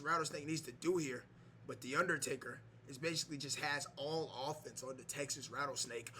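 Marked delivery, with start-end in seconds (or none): none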